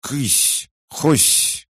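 very high, hissy yelps; creature squeak-hiss. A narrator's reading. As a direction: yelp garbled words like an indignant creature. Indignant doll trying to speak. (писклявое шипение зверька)

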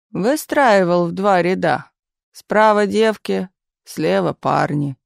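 slow, musing leader, phrase by phrase; pensive. A narrator's reading. Stalin muses on how Suvorov married off peasants, pensive. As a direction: muse aloud slowly, spacing out each phrase. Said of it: "задумчиво сказал вождь".

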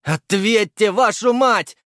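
furious yell into a radio; angry shout. A narrator's reading. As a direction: bark it in frustration. Soldier swearing into radio.

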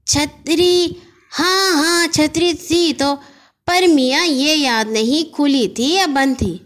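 a chatty corner shopkeeper; shopkeeper Khairu. Acted. Khairu the shopkeeper tells the boy, lightly voiced.

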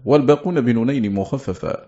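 ordinary talk, nothing marked

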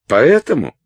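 high, loud accusing question; pressing demand. A narrator's reading. Салли presses Уилт for his reason, accusing. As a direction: push for the answer sharply. Салли язвительно допрашивает Уилта.

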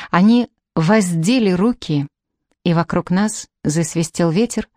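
plain reading voice

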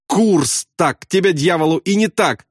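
high, angry demand over the intercom; cracking-voice yell. A narrator's reading. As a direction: curse in a strained high cry. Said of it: "заорал в переговорное устройство Макват срывающимся голосом".

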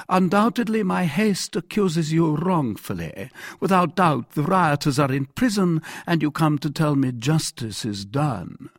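lightly voiced monarch, mock-conciliatory; ironic king. King pretending to excuse his captain, ironic. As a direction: feign giving the benefit of the doubt.

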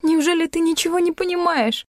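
high-pitched, overwrought question; tearful plea. A narrator's reading. Heroine begs Klim to understand, tearful. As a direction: plead in distress, near tears. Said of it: "зарыдала я".

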